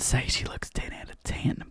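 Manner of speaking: breathy whisper aside, voice dropped right down. Acted. Podcast host whispers a catty aside about [name]. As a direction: let it slip as a catty secret, barely voiced.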